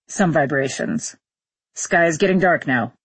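understated pilot's observation; astronaut on radio. Acted. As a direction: report the ascent matter-of-factly. Astronaut reports during the ascent.

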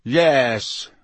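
mildly resentful one-word answer. A narrator's reading. Malfoy answering Moody about Snape, a touch resentful. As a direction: give the answer grudgingly. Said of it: "said Malfoy resentfully".

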